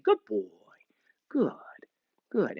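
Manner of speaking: low, soft praise for the dog. Shown as a demonstration. Trainer praising the dog softly while it holds the stick. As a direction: murmur it gently, no command. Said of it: "I'm just saying".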